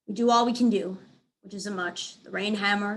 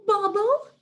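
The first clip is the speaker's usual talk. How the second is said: high sing-song call, louder; 12 semitones higher, 6 dB louder than usual. A narrator's reading. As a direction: call out sweetly. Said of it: "Snickers calls".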